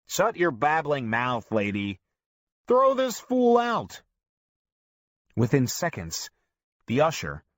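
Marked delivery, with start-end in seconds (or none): 0.1-4.0 slow, loud, rising to a higher shout; annoyed moviegoer yelling at grandma; angry heckler. A narrator's reading. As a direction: bark at the old lady to get out. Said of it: "People start yelling profanities at her".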